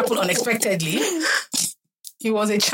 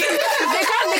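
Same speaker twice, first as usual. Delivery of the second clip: laughing; 11 semitones higher than usual. Real, not acted. Laughing while mocking.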